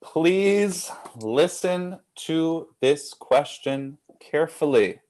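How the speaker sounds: very slow, word-by-word caution; slow, careful reading. Real, not acted. Host warns players about a tricky question, slow and deliberate. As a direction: space out each word deliberately. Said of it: "I'm gonna read it slowly".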